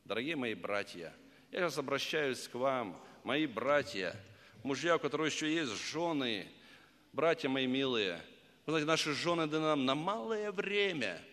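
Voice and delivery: ordinary talk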